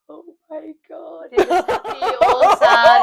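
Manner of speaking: high-pitched mock wail, swelling loud; mock tearful. Imitating receptionist moved by client's backstory, mock tearful wail. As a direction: imitate someone bursting into tears, hammed up. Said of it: "she'd just start crying".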